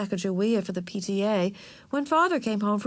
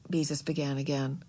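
plain reading voice

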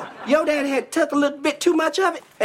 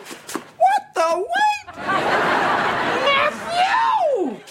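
surprised high shout; 12 semitones higher, much slower than usual. Acted. Sudden shock at nephew.

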